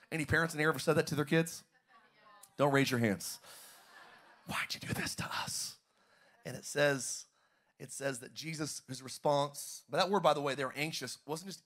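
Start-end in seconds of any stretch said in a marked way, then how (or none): 4.5-5.8 whisper. Acted. Parent's question, whispered.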